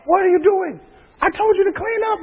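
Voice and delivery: high-pitched mock scolding mom; scolding wife. Wife catching kids trashing the room, high mock scold.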